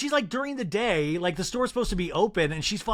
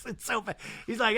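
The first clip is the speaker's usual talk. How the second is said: normal talking voice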